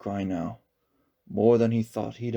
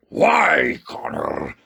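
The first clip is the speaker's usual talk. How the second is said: fierce; 4 semitones higher, 6 dB louder, much slower than usual. A narrator's reading. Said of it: "the monster said fiercely".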